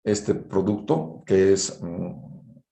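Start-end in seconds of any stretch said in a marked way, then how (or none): none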